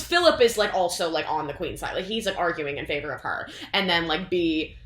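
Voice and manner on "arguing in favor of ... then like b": normal talking voice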